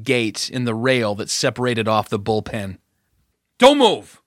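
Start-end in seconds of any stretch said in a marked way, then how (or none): 3.6-4.2 high, loud warning shout. A narrator's reading. Officer drawing his gun at an intruder, shouting. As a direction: shout to stop her dead. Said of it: "Cash shouted".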